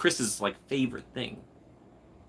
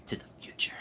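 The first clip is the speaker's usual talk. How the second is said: unvoiced whispered toast; 13 dB quieter than usual. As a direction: whisper the toast under your breath. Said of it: "And I'm like".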